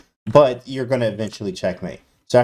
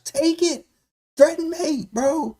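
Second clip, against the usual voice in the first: very high, shrill mock scream; slower than usual. Streamer screaming out a chat message for the viewers, in a mock scream. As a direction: scream the suggestion at the board. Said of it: "Screaming it".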